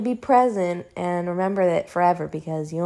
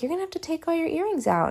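mom's warning; 9 semitones higher, 5 dB quieter, much faster than usual. Retold or imitated. Mom quoted warning about earrings.

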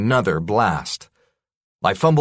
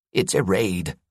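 hoarse rasp. Acted. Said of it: "Jasper rasped".

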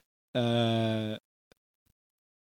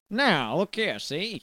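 put-on old newsreel gangster; 4 semitones higher, much slower than usual. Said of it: "has the energy of".

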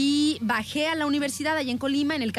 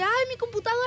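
high, winded plea; breathless cry; 11 semitones higher, much faster than usual. Retold or imitated. Retelling her plea to the driver, high and winded. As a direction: gasp it out after running. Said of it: "así toda así ahogada".

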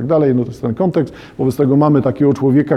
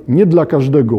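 ordinary talk

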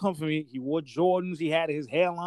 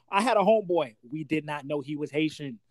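normal talking voice

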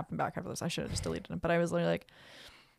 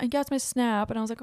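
ordinary talk, nothing marked

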